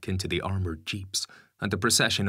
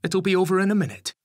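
high, louder voice for a mother; comforting mother; 12 semitones higher, 8 dB louder, faster than usual. A narrator's reading. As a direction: reassure a crying child in a raised, high voice. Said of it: "doing her best to comfort her".

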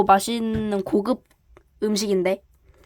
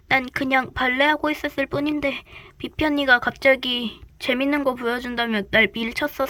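normal talking voice

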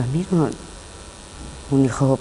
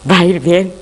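raised voice, pitched high and loud; mounting emotional insistence, climbing higher; desperate insistence; 6 semitones higher, 4 dB louder than usual. Acted. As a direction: insist on it as if convincing yourself. Insists marriage will improve.

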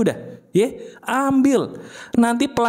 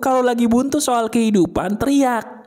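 normal talking voice

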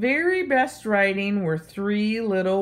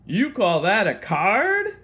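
smug. Acted. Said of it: "Spike said smugly".

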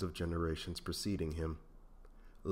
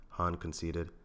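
usual speaking voice

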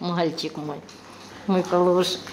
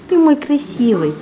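squeaky praising a dog; 6 semitones higher than usual. Real, not acted.

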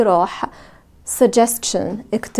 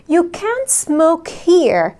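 very slow and higher, falling on here; slow model sentence; 6 semitones higher, much slower than usual. Shown as a demonstration. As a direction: drill it slowly for the class. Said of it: "ممكن نقول". (نطق بطيء جدًا وعالي)

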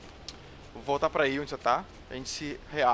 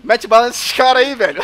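excited; 8 semitones higher, 12 dB louder than usual. Real, not acted. Urging ally to shoot.

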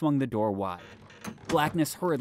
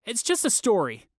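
trying to sound confident; 10 semitones higher, 5 dB louder than usual. A narrator's reading. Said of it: "trying to sound confident".

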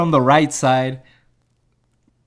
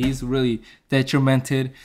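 ordinary delivery

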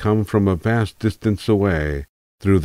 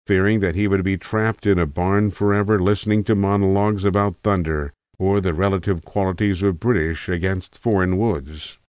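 usual speaking voice

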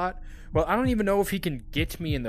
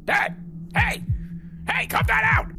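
high panicked shout. Real, not acted. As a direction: scream at him to stop. Dad attacking him in game.

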